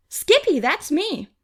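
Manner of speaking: high loud name, then a quick tag; excited boy. A narrator's reading. Skippy insisting on his name to Angel, excited. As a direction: pipe it up again eagerly. Boys repeat names excitedly.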